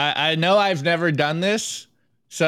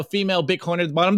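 ordinary talk

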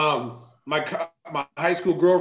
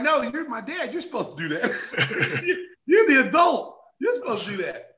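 put-on exasperated teen protest; indignant protest; 8 semitones higher than usual. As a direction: plead that he's the adult. Protesting to dad, pitched up.

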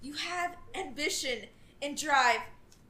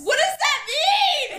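baffled shout; 11 semitones higher, 8 dB louder, slower than usual. Real, not acted. Baffled by the dress description.

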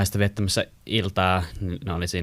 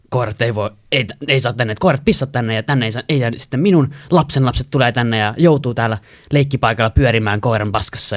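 loud, raised scolding about the grandkids; retold shouting; 3 semitones higher, 6 dB louder than usual. Older neighbour shouting that grandkids play in dog mess, loud and indignant. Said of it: "sit se alkaa huutaa vaan".

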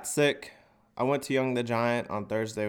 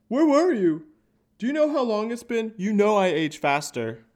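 high, loud, nagging mom voice; nagging mom dog; 10 semitones higher, 5 dB louder than usual. Retold or imitated. Dog scolding owner like a mom, high nagging. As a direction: scold like a worried mother, pitched high. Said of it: "it would be like your mom".

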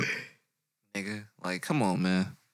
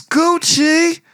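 high loud squeal ad-lib; 8 dB louder than usual. Retold or imitated. Imitating [name]'s ad-lib.

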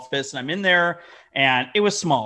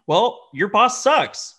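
slow and louder, deadpan put-down; blunt retort; 8 semitones higher, 8 dB louder, much slower than usual. Retold or imitated. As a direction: drag it out bluntly. Retold retort to employee.

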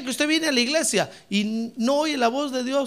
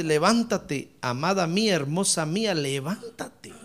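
lower, softened call to the beloved; tender invitation; 5 semitones lower, 3 dB quieter than usual. Acted. The Lord calling amada mía to rise, tender invitation. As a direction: coax lovingly, voice dropped low.

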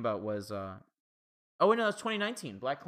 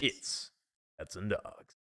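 low, soft mock announcer; hammed-up low trailer narrator; movie trailer voice; 4 semitones lower, 6 dB quieter, much slower than usual. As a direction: drop low for the film title. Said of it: "always wanted to do the".

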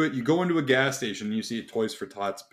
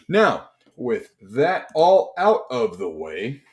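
normal talking voice

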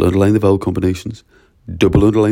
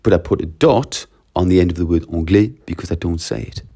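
ordinary talk

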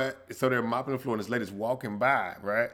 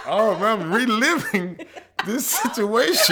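mock alarm; 9 semitones higher, 6 dB louder, slower than usual. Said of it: "I was like".

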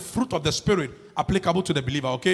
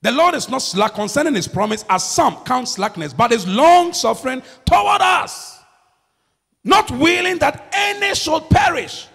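preaching the verse; 4 semitones higher than usual. Acted. Preaches scripture.

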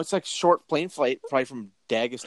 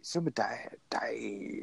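whispered sounding out of the name; whispering; 11 dB quieter, much slower than usual. Real, not acted. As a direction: whisper the syllables to yourself. Sounding out name under breath.

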